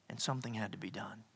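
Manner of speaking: a hushed, solemn whisper. Acted. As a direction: drop to a whisper to let it sink in. Dramatic pause in sermon.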